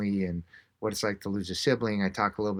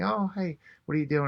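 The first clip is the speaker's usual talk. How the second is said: lightly voiced stranger, pitched higher; a hint of a surprised passer-by, high; friendly stranger's greeting; 8 semitones higher than usual. Cyclist greets someone asking directions, lightly imitated friendly greeting.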